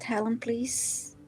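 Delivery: ordinary talk, nothing marked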